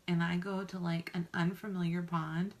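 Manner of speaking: ordinary talk, nothing marked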